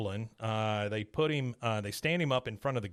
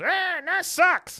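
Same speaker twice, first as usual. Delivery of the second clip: far higher, slow, sing-song mock voice; a passing driver taunting in a silly voice; mocking passerby; 7 dB louder, much slower than usual. A guy in a passing car teases Hank, high and slow.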